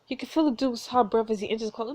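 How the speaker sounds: ordinary delivery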